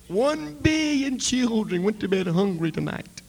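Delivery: rising, loud plea; impassioned cry. Retold or imitated. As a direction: cry it out to God. Preacher crying out to God.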